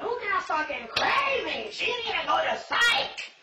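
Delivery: high, slow mocking voice of the other girls; imitating gossiping cellmates; mocking inmates. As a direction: mimic the inmates calling her crazy. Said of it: "They were all like".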